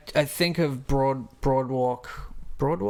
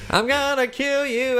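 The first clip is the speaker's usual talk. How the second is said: hammy gangster threat; 10 semitones higher than usual. Pretend.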